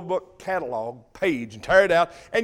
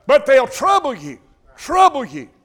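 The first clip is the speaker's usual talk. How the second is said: loud, high, hammering repetition; emphatic warning; 4 semitones higher, 6 dB louder than usual. Acted. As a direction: drive the warning home. Preacher pounding a warning.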